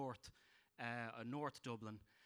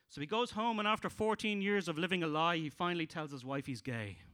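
joke telling; 3 semitones higher, 6 dB louder than usual. Acted. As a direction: push toward the punchline.

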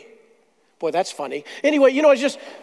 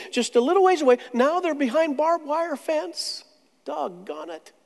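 normal talking voice

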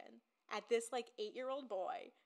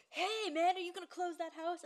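mock salesman greeting; 8 semitones higher, 7 dB louder than usual. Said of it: "and he's like".

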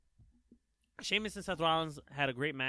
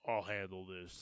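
low, quiet tough-guy line; lightly voiced Roman Reigns; 8 semitones lower, 9 dB quieter than usual. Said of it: "you're doing the Roman voice".

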